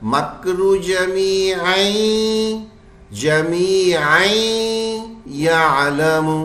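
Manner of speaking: slow chant, "जमीअन" gliding into a long nasal y; merged tanween into ya. Shown as a demonstration. Teacher shows students idgham, "जमीअन" melting into "यालमु". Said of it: "ये कैसे पढ़ा जाएगा?".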